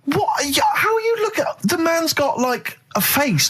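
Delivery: high, disbelieving outburst; incredulous yelp. Real, not acted. Host reacting to co-host noticing Jackson's hands, shrieked disbelief. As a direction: shriek in mock outrage at what he heard. Outraged disbelief at co-host's remark.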